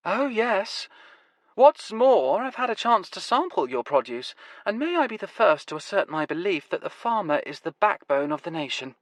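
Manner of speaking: grandly flattering the farmer; Nevada's voice. Acted. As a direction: arch mock-praise.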